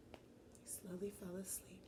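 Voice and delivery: sleepy hushed whisper. Shown as a demonstration. Teacher echoing Lucas falling asleep, whispered. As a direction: lull it out in a whisper. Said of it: "repeated as whisper".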